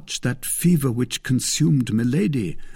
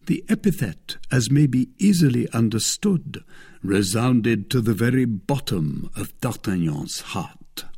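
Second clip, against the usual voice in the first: usual speaking voice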